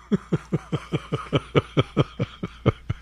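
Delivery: soft breathy laugh; quiet snicker. Real, not acted. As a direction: snicker under your breath. Laugh after joke.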